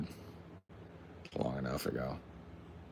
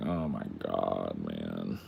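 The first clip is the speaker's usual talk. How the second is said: deep, slow mock lament; teen lamenting being grounded, mock-tragic; mock despair; 11 semitones lower, 6 dB quieter, much slower than usual. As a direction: groan it out low and slow, hammed-up. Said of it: "I was like".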